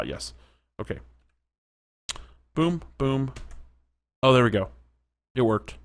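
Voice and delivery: ordinary talk, nothing marked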